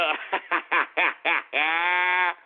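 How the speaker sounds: long, rolling cackle; drawn-out self-satisfied laugh; gloating laugh. Real, not acted. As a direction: crack up at your own success. Gloats over making money.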